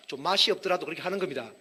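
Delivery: ordinary talk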